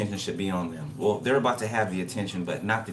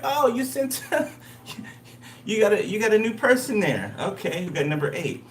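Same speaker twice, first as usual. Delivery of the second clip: much higher and louder, laughing through it; laughing mock reaction; 12 semitones higher, 3 dB louder than usual. Reader mocking those who sent someone new, high laughing. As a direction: chuckle while catching them out. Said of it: "I'm like".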